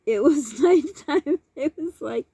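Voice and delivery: slow, laughing voice; laughing through the words. Real, not acted. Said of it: "have a good laugh".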